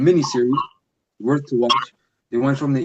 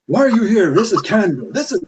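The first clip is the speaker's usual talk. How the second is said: high, louder mock outrage "Why are you here? This is Canada"; mocking high indignation; 7 semitones higher, 3 dB louder than usual. Mimicking Esau's favorite line, high and indignant. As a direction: sneer it like a smug local telling someone to leave. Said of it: "that's Esau's favorite line".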